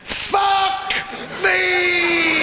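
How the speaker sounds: woman startled and exclaiming; high shouted exclamation. Acted.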